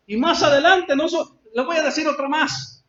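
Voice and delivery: loud, high sermon delivery; fervent preacher; preaching at full force. Acted. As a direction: drive the story home from the pulpit.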